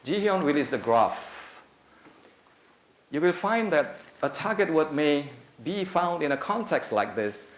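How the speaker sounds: ordinary delivery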